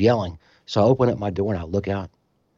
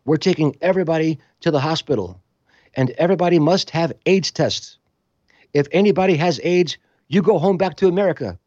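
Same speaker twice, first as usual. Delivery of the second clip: Greek official; 6 semitones higher than usual. Retold or imitated. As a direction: announce it like a stern foreign official. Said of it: "they go".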